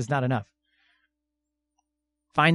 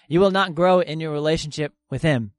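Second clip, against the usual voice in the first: ordinary talk, nothing marked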